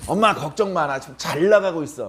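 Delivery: acted boast of a grown child; blustering reassurance. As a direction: put on bravado to reassure mom. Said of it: "약간 허풍이 섞이는 거죠".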